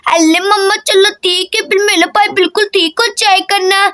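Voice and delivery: put-on childish baby-talk lisp; lisping little girl. Voices little girl Daisy doll.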